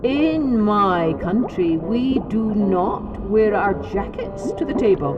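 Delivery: slow, measured, dignified turtle; calm and deliberate, savoring revenge; trickster tale voices. Acted. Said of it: "and spoke".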